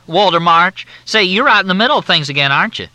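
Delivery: high, loud, syrupy Southern drawl; Southern officer on the phone, in a thick drawl. Acted. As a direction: drawl it out like a courteous Southern major. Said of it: "The drawl was so steeped in courtesy".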